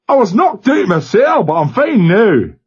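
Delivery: broad Glasgow lad on a crackly line; Glasgow accent. A narrator's reading. Glasgow boy answering the captain over the speaker, Glasgow accent. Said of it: "faint tremor below".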